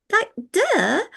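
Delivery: a high, sarcastic "duh", thrown away; mock duh. Teasing friends about technology, a playful high duh. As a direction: toss it off like it's obvious.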